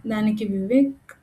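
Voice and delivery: ordinary delivery, nothing marked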